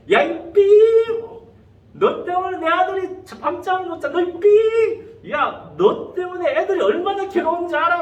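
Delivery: high, loud acted outburst; angry father's tirade. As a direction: tear into the bully like a furious dad. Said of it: "막 퍼붓는 거야".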